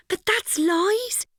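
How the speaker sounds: put-on a shocked girl protesting to her friend; scandalised. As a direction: gasp out the objection. Shocked at friend's plan to lie.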